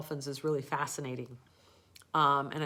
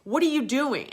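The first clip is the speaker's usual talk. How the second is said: much higher, louder repeated demands; imitating a trainer barking questions; hammed-up angry questioning; angry interrogation; 9 semitones higher, 10 dB louder than usual. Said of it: "got really angry".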